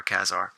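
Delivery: Kazar said as KAZ-ar; short "a" as in cat opening Kazar; second pronunciation variant. Shown as a demonstration. Collector guessing what a movie might settle, Kazar as KAZ-ar. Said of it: "whatever way you pronounce it".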